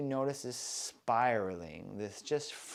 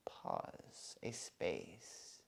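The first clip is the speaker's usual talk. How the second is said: whispered; 8 semitones lower, 14 dB quieter, much slower than usual. Shown as a demonstration. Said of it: "perhaps you notice a slight".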